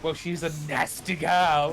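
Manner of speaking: drawn-out, sneering villain voice; villainous drawl. Pretend. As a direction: savour every word like a wicked schemer. Campy villain talk about governess.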